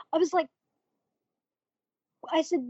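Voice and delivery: ordinary delivery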